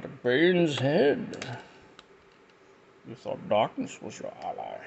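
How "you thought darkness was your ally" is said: Bane voice. Retold or imitated. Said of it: "That was a horrible impression".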